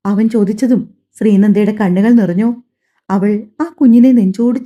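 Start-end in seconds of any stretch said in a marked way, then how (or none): none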